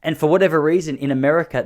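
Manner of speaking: ordinary talk, nothing marked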